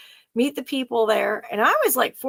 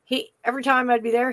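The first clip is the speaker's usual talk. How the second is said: normal talking voice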